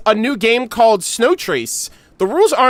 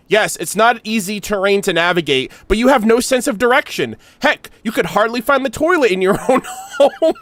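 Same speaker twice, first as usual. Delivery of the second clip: ordinary delivery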